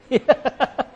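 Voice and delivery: high chuckling laugh. Real, not acted. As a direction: burst into teasing laughter. Teasing Trace, laughs.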